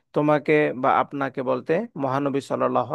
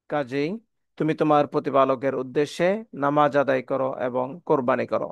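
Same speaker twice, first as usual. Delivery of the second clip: ordinary delivery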